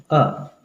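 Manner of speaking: short open अ for the letter A; American English article sound. Shown as a demonstration. Teacher shows learners American English, short open अ. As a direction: say अ as a short, neutral American vowel. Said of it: "American English में इसको बोला जाता है".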